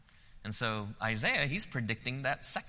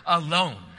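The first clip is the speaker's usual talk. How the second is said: alone said louder and higher, drawn out; stress on alone; 6 semitones higher, 10 dB louder than usual. Shown as a demonstration. Preacher stressing "alone" to the congregation, emphatic. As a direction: lean hard on "alone". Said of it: "I emphasize that word".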